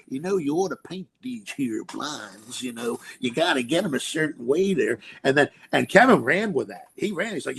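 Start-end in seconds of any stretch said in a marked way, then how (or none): 0.1-5.0 put-on Southern accent. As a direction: play Uli in a lazy Southern accent. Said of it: "Southern accent".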